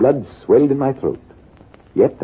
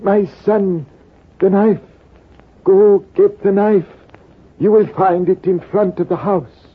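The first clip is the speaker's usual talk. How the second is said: put-on strained old man voice, pitched up; old Persian; 7 semitones higher than usual. Buried old Persian urging the soldier to fetch the knife, strained. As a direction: speak with effort, an old dying man. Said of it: "The old Persian spoke with difficulty".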